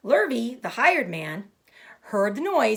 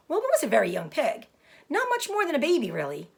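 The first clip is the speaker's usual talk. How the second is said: ordinary delivery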